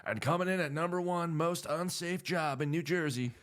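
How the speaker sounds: high, showy radio host countdown; mock radio countdown host; radio announcer. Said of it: "the guy was like".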